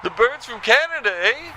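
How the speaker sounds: hammed-up Canadian caller tacking on eh; Canadian accent.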